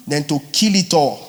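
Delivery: ordinary talk, nothing marked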